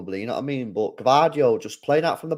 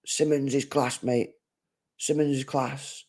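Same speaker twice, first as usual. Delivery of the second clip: imitating a fan; 4 semitones higher, 3 dB quieter, slower than usual. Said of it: "you're always on to me about".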